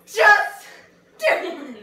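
shouting. Pretend. Motivational shout.

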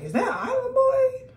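high shrieking disbelief; shocked friend. Retold or imitated. Best friend texting back, high shocked squeal. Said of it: "she like".

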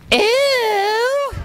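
long, high squeal of disgust. Acted. Ladies in waiting offered for kisses, high disgusted squeal. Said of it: "Well, they all went".

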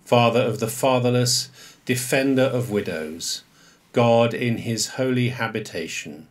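measured, solemn reading of the psalm; liturgical psalm reading. Acted. Priest leading the congregation in the psalm, measured liturgical reading.

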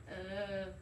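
ordinary talk

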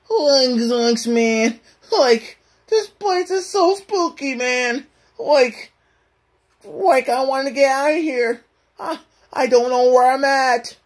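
high, spooked cartoon slacker; Shaggy. Shown as a demonstration. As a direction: whimper high like a scared cartoon sidekick. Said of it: "to do the Shaggy voice".

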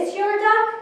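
very high, loud question; urgent question. Acted. Alligator asks at skydiving, high-pitched urgent question.

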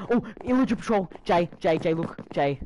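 high, loud excited call; excited shout. Real, not acted. Spots rare mob, calls out.

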